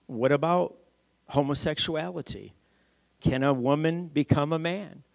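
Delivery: ordinary talk, nothing marked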